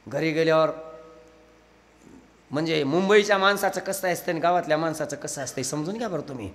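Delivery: ordinary talk, nothing marked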